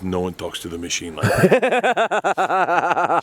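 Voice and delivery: slow, heavy gangster threat; menacing gangster. Retold or imitated. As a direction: lay it down like a mob tough. Gangster defends him.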